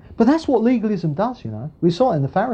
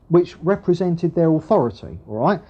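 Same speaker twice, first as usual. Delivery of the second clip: normal talking voice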